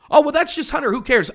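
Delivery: mock the excuse as if it is nothing; hammed-up sarcastic brush-off; mocking dismissal.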